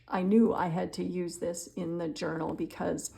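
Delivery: usual speaking voice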